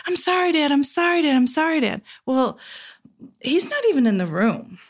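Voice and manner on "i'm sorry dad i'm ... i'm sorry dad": put-on child apologizing over and over, pitched up; a small boy's anxious sorry, fading each time; boy saying sorry to his dad, higher; repeated apology. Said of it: "What I do is I say".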